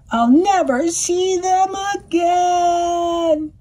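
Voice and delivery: high, slow, sing-song wail. A narrator's reading. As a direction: wail it like a heartbroken duck. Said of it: "wailed the duck".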